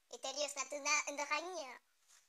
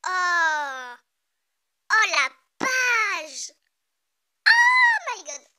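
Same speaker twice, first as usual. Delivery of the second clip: awed scream; 2 semitones higher, 15 dB louder, much slower than usual. Real, not acted. Reader stunned by a page, screaming in awe. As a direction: let the amazement burst out.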